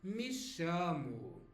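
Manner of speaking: me chamo said slowly, shu-mu ending; slow it down so learners catch the Brazilian order; drawn-out me chamo with a falling end; slow Brazilian demo. Shown as a demonstration. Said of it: "more likely to hear they say".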